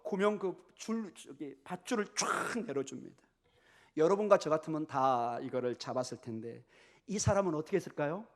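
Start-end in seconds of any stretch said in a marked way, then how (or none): none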